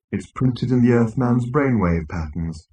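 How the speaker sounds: slow, droning gloomy robot. Acted. Marvin explaining to the crew, depressed. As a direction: drone it out wearily.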